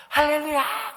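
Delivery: 할렐루야 as a wild ecstatic cry; overjoyed shout. Retold or imitated. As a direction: scream 할렐루야 with delight like the old pastor. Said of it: "이분이 전화기로".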